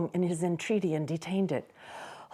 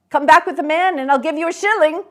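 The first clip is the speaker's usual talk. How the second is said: fast, loud, higher eager offer; eager Scrooge; 11 semitones higher, 11 dB louder, much faster than usual. Acted. Scrooge urging the boy to fetch the poulterer, eager. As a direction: hurry the boy along with bribes.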